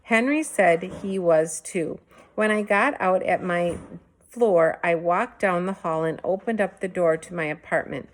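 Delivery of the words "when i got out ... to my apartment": ordinary delivery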